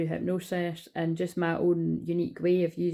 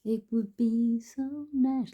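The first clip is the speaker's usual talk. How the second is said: dreamy; 6 semitones higher, much slower than usual. Real, not acted. Dreaming about a holiday.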